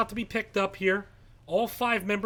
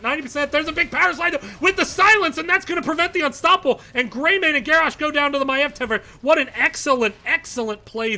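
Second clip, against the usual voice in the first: rising, louder, hyped up play-by-play; excited call; 6 semitones higher, 7 dB louder, faster than usual. Real, not acted. Caster calling the silence combo to viewers, excited. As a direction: shout the big play as it lands.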